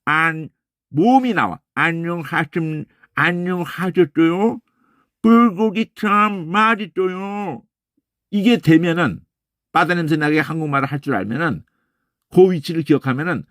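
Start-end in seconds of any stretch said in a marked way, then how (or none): 1.8-7.6 slow Korean with curled American tongue; "안녕하셨어요" said like an American speaking Korean, slow; American accent in Korean. Shown as a demonstration. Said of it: "혀 꼬부라지는 소리 하면 어떻게 되는지".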